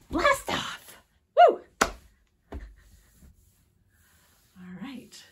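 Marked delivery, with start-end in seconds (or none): 0.1-1.6 high, loud blast-off cry; excited shout. Acted. Teacher shouting blast off after the countdown. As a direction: launch it with a big shout. Countdown ends in rocket launch.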